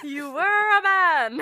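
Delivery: gleeful exclamation. Real, not acted. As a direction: crow it slowly through the amusement. Laughing reminder about the disguise.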